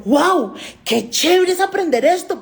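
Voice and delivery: exclamación alegre, más aguda y fuerte; la perra feliz descubriendo algo nuevo; perra entusiasmada. Pretend. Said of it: "te estaba diciendo". (la doctora imagina a la perra encantada, voz aguda y alegre)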